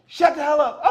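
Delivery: bossy command. Acted. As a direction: bark the order at her. Acting out a couple's quarrel.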